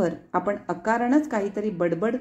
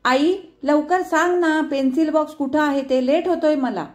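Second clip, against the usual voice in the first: calling out; 7 semitones higher, 5 dB louder than usual. A narrator's reading. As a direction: yell to mom from the other room. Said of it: "लेक ओरडला".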